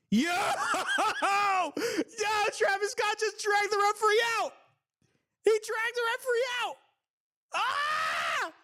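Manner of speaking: laughing, high-pitched excited yelling; hype scream. Real, not acted. Streamer watching the wrestling match screaming with excitement. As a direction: lose it with laughter and shock. [name] drags referee out.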